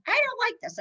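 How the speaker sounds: high, mock-whiny child complaint; whiny kids voice. Kids complaining to mom, high mock whine. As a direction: mimic a sulky kid protesting.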